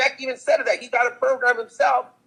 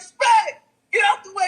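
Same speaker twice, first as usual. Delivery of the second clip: high-pitched excited calling out; 9 semitones higher, 4 dB louder than usual. Retold or imitated. Rapper clearing the way to his friend, excited call. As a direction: imitate hailing a friend across a crowd, voice way up. Imitates [name] calling through crowd.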